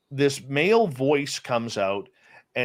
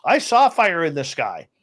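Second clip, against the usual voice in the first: excited protest; 8 semitones higher than usual. Retold or imitated. Protesting to his buddy about aliens, high and worked up. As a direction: push back hard, voice up high.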